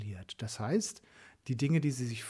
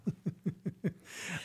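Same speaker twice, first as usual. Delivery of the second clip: soft laugh; 5 semitones higher, 5 dB quieter than usual. Real, not acted. Lacht über eigene Frage.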